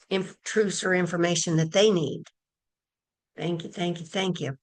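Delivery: usual speaking voice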